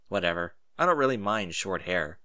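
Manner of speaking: plain reading voice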